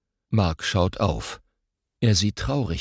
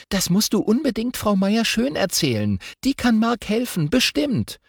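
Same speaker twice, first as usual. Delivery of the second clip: put-on much higher, urgent little girl; eager girl voice; 14 semitones higher than usual. Girl urging her brother to tell the teacher, eager. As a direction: push him to go to the teacher.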